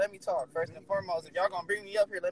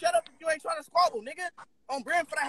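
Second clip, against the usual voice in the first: worked up, higher-pitched challenge; yelling; 6 semitones higher, 4 dB quieter than usual. Real, not acted. Caller daring a rival to squabble, yelling. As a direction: raise the pitch in real anger. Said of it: "Why you yelling?".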